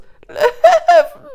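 high, loud jeering cackle; hyena cackle. A narrator's reading. Hyenas jeering at the cornered cub, wild cackle. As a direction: burst into shrieking, chattering laughter. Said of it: "brachen in Schnattern und Johlen aus".

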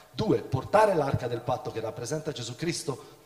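usual speaking voice

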